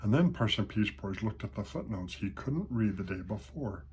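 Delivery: usual speaking voice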